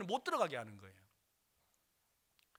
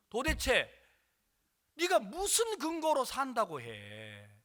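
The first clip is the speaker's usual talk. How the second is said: high, drawn-out challenge; angry demand; 6 semitones higher, 5 dB louder, slower than usual. Retold or imitated. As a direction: imitate an exasperated father demanding proof. Father grabs collar, demands answer.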